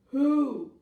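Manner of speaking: big low booming call; loud, low storybook bear; asking bear. Acted. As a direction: boom the word out as the bear. Bear asks again in story.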